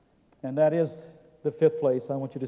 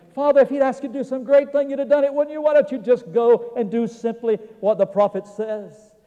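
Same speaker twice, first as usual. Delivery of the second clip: high, loud imitated appeal; pleading reasoning; 8 semitones higher, 8 dB louder than usual. Servants urging their master to obey the prophet, reasoning. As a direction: press the point as if talking someone round. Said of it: "tried to reason with him".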